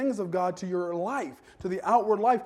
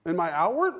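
ordinary talk

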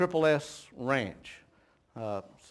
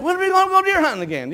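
much higher and fast, bubbling over; excited kid; 7 dB louder, faster than usual. Retold or imitated. Five-year-old asking his daddy in the dark, eager and excited. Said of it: "he's all excited".

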